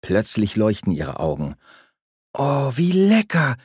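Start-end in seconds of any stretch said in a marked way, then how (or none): 2.3-3.6 slow, high, amazed delight; surprised by how good it tastes; delighted surprise. A narrator's reading.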